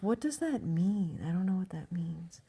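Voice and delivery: mock innocent, soft and hushed question; playing dumb. Acting clueless to the women with the sign, playing dumb. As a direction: feign cluelessness, softly. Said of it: "I was playing dumb".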